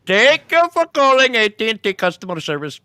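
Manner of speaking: put-on very high, loud Pakistani accent; exaggerated Pakistani customer-service greeting. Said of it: "work on my Pakistani accent".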